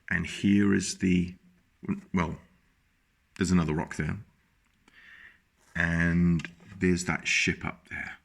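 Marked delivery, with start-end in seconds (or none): none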